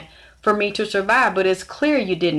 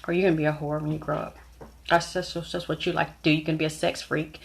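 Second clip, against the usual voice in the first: fast, softer mocking mother voice; imitating her mother's sneer; 3 semitones lower, 7 dB quieter, much faster than usual. Mother taunting her six-year-old before friends, fast soft sneer. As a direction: rattle it off like a scornful mother taunting her child. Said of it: "my mother's sitting here in front of her friends".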